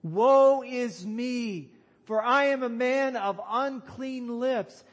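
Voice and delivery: loud, high cry of woe; impassioned prophet's lament; Isaiah. Acted. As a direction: cry it out in dread awe, the first words stretched slow. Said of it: "you know what his thought was".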